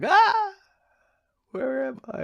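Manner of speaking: a high, startled yelp that drops to a dazed question; lost ADHD mind. Retold or imitated. The ADHD mind jumping off the wagon, high and startled. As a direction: play a mind that just lost its train of thought. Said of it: "It's like".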